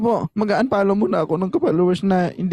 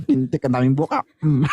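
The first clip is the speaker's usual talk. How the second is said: ordinary talk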